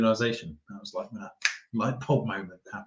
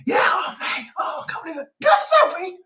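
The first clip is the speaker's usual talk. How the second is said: imitating excited fan; 5 dB louder, faster than usual. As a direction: shriek with starstruck joy. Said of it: "he was so excited".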